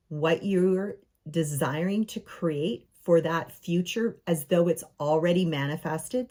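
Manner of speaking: usual speaking voice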